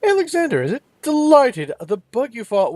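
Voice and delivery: a flamboyant elf savouring the name; theatrical elf. Acted.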